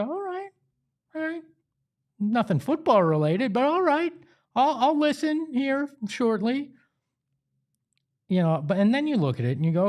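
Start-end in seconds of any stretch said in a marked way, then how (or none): 0.0-6.7 much higher, skeptical all right, all right; mock reluctant. Host imitating his own doubtful reaction to the hire, higher mock reluctance. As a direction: humor the news with a doubtful shrug in the voice. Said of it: "I go".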